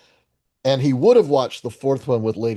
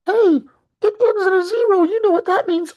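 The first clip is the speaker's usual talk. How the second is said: much higher, louder squeaky little voice; squeaky cartoon voice; 6 dB louder than usual. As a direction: pop in as a tiny shrill sidekick. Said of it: "That voice?".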